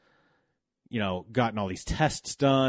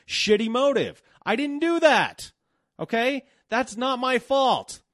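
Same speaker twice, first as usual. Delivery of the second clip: high-pitched, exasperated outburst; a cornered guy's high-pitched angry comeback; indignant retort; 11 semitones higher than usual. Retold or imitated. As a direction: fire it back, voice climbing high in disbelief. Retort to accusing coworkers.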